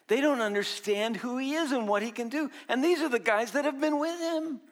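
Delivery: ordinary delivery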